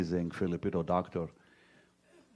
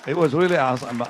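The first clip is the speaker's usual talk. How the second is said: louder and higher, bursting with joy; excited; 5 semitones higher, 9 dB louder, much faster than usual. Real, not acted. Telling an audience about waking up praising, excited. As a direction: let the wonder spill out loud.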